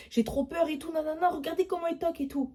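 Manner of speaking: a hint of whiny panic; scared sisters. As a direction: mimic the frightened sisters, whiny. Mocking scared sisters' voices.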